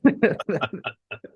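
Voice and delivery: a high, tickled laugh. Real, not acted. Still laughing about the pub story, high laugh. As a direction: let the laugh ride up high. After retold reply.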